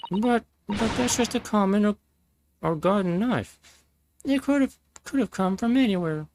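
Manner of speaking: high, flustered lady's voice; high put-on lady's voice, protesting; stupid Garrodeb voice. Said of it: "continue this stupid voice".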